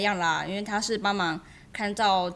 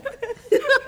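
embarrassed squeal laugh; 7 dB louder than usual. Real, not acted. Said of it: "在大家面前这样讲话".

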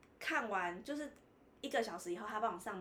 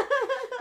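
高声爆笑; 笑得停不下来; 11 dB louder than usual. Real, not acted. 吼完笑出来. (吼完自己忍不住高声大笑)

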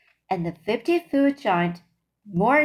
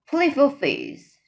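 higher-pitched exclaimed insult; mild shout; 6 semitones higher, 3 dB louder than usual. A narrator's reading. Blood bottler shouts at the BFG, mildly raised voice. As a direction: throw it out as a half-voiced shout. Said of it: "shouted the blood bottler".

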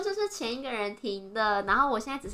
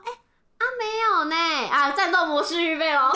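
high, louder mock objection; retold combative objection; 6 semitones higher, 4 dB louder than usual. Retelling the lady disputing a parking spot, high combative imitation. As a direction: object in a pitched-up imitation, ready to fight.

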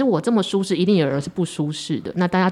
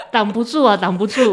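laughing out loud; bursting out laughing; 7 semitones higher, 5 dB louder than usual. Real, not acted. Laughing at mask smile story.

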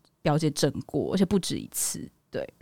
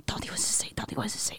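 whispering; 2 semitones higher, 14 dB quieter than usual. Retold or imitated. Retelling late-night worried chat.

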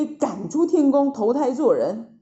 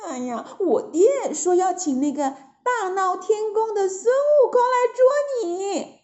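put-on warning girl; 7 semitones higher than usual. A narrator's reading. Girl warns of Sun Wukong.

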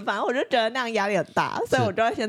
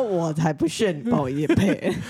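imitating him; 2 semitones lower than usual. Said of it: "他说".

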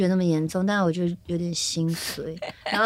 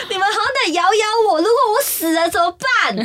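mock wounded outrage; 12 semitones higher, 5 dB louder than usual. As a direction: throw the hurt accusation at your friends with a tearful laugh. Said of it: "有点心寒".

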